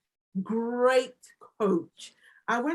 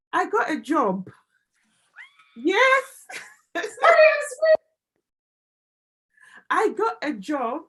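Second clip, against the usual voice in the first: exultant shout of good news, pitched high; overjoyed whoop breaking into a cry; real elation; 8 semitones higher, 8 dB louder, slower than usual. Real, not acted. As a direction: cry out the big win, loud and high. Breaks out celebrating new job.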